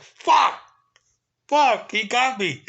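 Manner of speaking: loud, high cry of comic defeat; exasperated outburst. Retold or imitated. Guard beaten by guest's Spanish, exasperated outburst. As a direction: replay the curse at full exclamation, very high.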